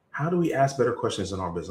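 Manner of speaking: brisk restatement; quick repeat. Shown as a demonstration. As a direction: rattle it off quickly.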